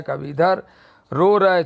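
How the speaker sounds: ordinary delivery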